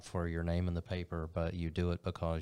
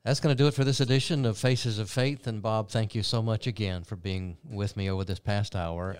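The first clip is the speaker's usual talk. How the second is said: louder host sign-off voice; upbeat TV host wrapping up the hour; show sign-off; 3 semitones higher, 6 dB louder than usual. Acted. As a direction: close the broadcast like a TV host.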